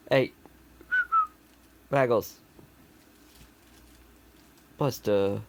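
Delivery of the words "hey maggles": ordinary talk, nothing marked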